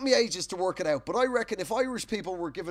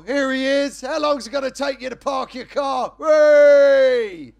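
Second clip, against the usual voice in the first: loud, higher matey shout; Big Ron's banter; 6 semitones higher, 13 dB louder, slower than usual. Retold or imitated. Neighbour Ron teasing him about parking, loud and matey. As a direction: rib him cheerfully across the street. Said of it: "And he'll go".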